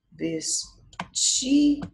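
"Vestido" slowly split, "ti" high and loud; ударный слог. Shown as a demonstration. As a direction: punch a long high "ti" in "Vestido". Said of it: "da sílaba mais forte".

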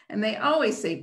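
usual speaking voice

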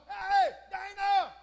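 long, drawn-out high shout; high-pitched holler, stretched out slowly; holler across the house. Retold or imitated. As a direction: call out loudly to someone in another room. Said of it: "and I'd holler".

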